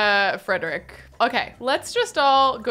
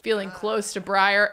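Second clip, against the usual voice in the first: ordinary talk, nothing marked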